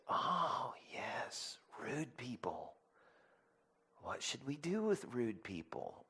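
soft, half-whispered encouragement; hushed agreement. Retold or imitated. Dad drawing out his son, hushed and encouraging. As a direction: whisper it like a knowing confidant.

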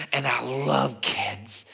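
slow, drawn-out LOVE in a put-on voice; imitated gushing. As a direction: stretch out "love" like a doting grandfather. Grandfather gushing, slowly, to tired father.

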